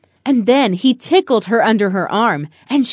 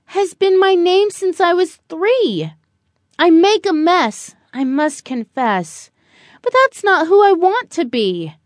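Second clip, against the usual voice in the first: put-on higher, earnest child's voice; a small girl owning up to her dad; little girl Lily; 6 semitones higher than usual.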